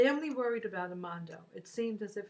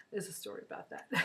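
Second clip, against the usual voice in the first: teary, choked-up voice; fighting back tears; after crying; 8 dB quieter, faster than usual. Real, not acted. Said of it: "I'm kinda teary".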